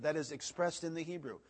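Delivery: ordinary delivery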